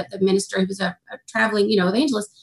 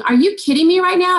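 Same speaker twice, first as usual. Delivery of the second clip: disbelief; 8 semitones higher, 4 dB louder than usual. Retold or imitated. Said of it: "I'm thinking".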